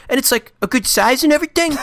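put-on very high, silly British accent; squeaky, hammed-up British worry; Hugh Grant accent. Father-to-be asking the doctor about his baby, silly British accent. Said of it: "this is me doing a stupid accent".

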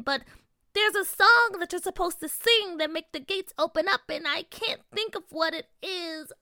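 high fairy queen, fretting; lightly voiced fairy, ending in distress; cold regal fairy queen. A narrator's reading.

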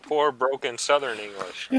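ordinary talk, nothing marked

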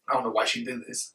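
ordinary talk

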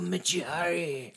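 slow, drawn mock Japanese man accent. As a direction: stretch it like a samurai movie. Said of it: "The guy I can do pretty good".

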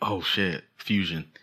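ordinary talk

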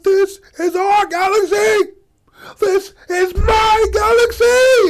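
high, slow shouted speech, voice cracking; booming rousing general speech. Acted. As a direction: roar it to the crowd, near tears. Leader's speech, voice breaking.

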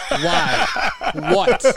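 laughing. Real, not acted. Laughing at the [name] news.

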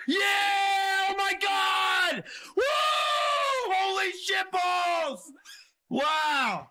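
high, loud, slow drawn-out yells of joy; stretched-out victory shout; ecstatic shouting. Real, not acted. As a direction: scream with delight at a huge win. Big win on the slot.

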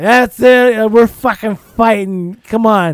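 put-on loud, slow drunken dare to fight; imitated drunk challenge. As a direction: bark it like a drunk picking a fight. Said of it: "he's like".

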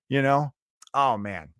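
usual speaking voice

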